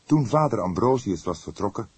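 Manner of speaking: plain reading voice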